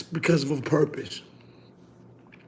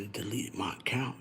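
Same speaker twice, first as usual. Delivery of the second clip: whispered, much softer mob meeting talk; mock mafia whisper; 7 semitones lower, 14 dB quieter than usual. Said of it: "they talk low".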